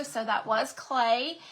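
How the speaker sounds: ordinary talk, nothing marked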